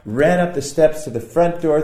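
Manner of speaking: ordinary delivery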